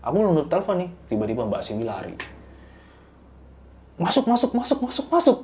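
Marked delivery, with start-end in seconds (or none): 4.0-5.4 high, loud hurried commands to get in; urgent shouting. Retold or imitated. Woman yelling at the group to get in the car, high and loud. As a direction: yell at everyone to get inside now. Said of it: "gitu katanya".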